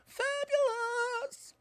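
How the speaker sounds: high, slow camp falsetto; camp fabulous. Pretend. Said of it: "Or we could go".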